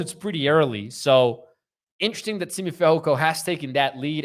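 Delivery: ordinary delivery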